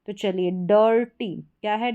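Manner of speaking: normal talking voice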